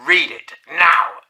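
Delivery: slow, loud, clipped order; stern command. Retold or imitated. Teacher ordering the pupil to read now, slow and loud. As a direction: bark out each word of the order.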